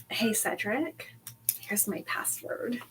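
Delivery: very soft, slow murmur; barely audible, furtive aside; secretive hush. Acted. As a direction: slip it to them under your breath. Cho Chang slipping Cedric the password.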